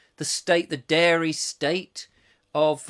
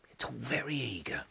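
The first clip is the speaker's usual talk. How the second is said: much softer, lower echo; under the breath, lower; quiet aside; 4 semitones lower, 12 dB quieter than usual. Real, not acted. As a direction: drop it to a small murmured aside. Echoes own remark as aside.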